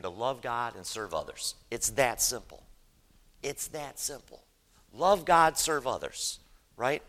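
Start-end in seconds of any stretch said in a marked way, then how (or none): 3.4-4.4 softer, gently emphatic; hushed repeat. Real, not acted. Preacher repeats his point to the church, hushed. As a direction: say it quietly to let it sink in.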